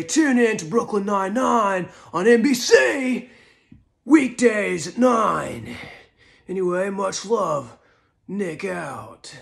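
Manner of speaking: hammy Nicolas Cage plugging his show; Nicolas Cage impression. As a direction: Nic Cage thanking his fans, hammed up. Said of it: "this is an impression of the most famous line".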